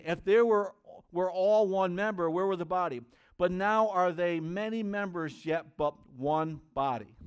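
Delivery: loud, slowed scripture read to the church; loud sermon reading; forceful scripture reading. Acted. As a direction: proclaim each line of the verse. Reads scripture at full force.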